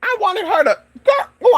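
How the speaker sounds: high, loud frustrated cursing; exasperated squealed outburst; real frustration. Real, not acted. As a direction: curse in a high wail at your own blunder. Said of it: "I'm so mad right now".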